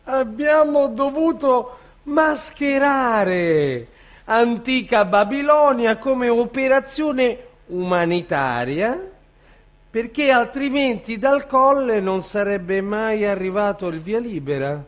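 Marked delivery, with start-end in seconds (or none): none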